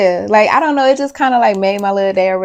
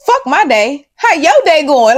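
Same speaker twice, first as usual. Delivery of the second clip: high, loud mock-indignant reply; imagined outburst; 8 semitones higher, 6 dB louder than usual. Child politely asks about her day, mock-indignant outburst. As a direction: blurt it as an astonished inner thought.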